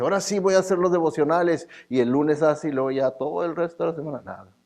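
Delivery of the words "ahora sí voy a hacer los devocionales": rattled off quickly, a little higher; hasty mock resolution. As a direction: rush the promise as if it cost nothing. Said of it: "como quien dice".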